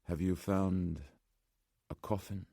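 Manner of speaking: put-on soft, halting Slavic-accented old man; pause, then softly name it in a Slavic accent. A narrator's reading. Old man asking about the coffin, softened Slavic accent. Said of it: "The man spoke with an accent".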